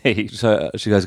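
normal talking voice

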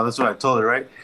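usual speaking voice

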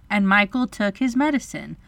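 plain reading voice

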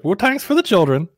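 high bird voice. Pretend. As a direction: answer as a silly little bird. Voicing the bird's reply.

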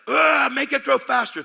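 hammed-up high, loud growl of rage; mad yelling. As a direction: explode into the phone, comically enraged. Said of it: "I was so mad".

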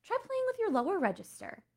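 high-pitched quoted suggestion; acted professor. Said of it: "my professors would be like".

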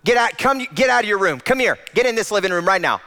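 impatient parent shouting; demanding father yelling. Retold or imitated. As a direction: order the kid out of his room, fast. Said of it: "ask a question demanding his presence".

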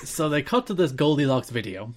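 normal talking voice

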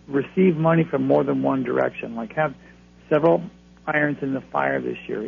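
normal talking voice

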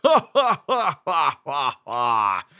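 hammed-up rolling belly laugh; hardcore belly laugh. Shown as a demonstration. Brother practising laughs for his sister, big belly roll. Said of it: "one of those hardcore belly roll laughs".

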